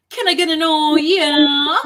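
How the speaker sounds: high, loud hype-up holler; rallying call. Retold or imitated. Actress cueing the whole crew in a prank, high rallying shout. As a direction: call it out like rousing a crowd.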